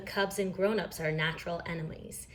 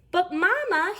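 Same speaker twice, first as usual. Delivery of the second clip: high, louder plea; protesting cub; 12 semitones higher, 5 dB louder than usual. A narrator's reading. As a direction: whine up at mother. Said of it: "he protested".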